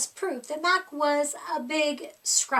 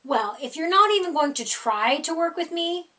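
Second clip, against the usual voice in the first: whining monster; 5 semitones higher, 5 dB louder than usual. A narrator's reading. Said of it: "Mac whined".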